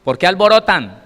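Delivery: loud, sharp rebuking question; stern rebuke. Acted. Jesus scolding the wailing mourners, loud. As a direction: scold the noisy crowd.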